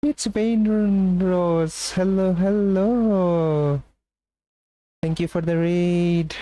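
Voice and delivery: high, drawn-out squeal of welcome; excited greeting. Real, not acted. Streamer welcoming a raider, excited greeting. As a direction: sing out a long, high, delighted hello.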